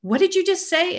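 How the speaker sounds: stunned question. Retold or imitated. To the tech who named her disease, shocked. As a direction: pitch leaps up in shock. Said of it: "I said".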